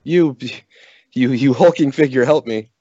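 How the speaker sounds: high-pitched damsel-in-distress act; feigned frantic pleading. Acted. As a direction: plead for rescue in exaggerated panic. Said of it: "frantically screaming".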